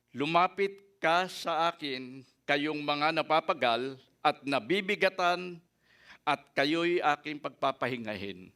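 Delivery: slow, solemn reading of Jesus' words; scripture reading. Acted. Preacher reading Matthew eleven twenty-eight to the congregation, slow and solemn. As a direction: lay the verse out gently and slowly.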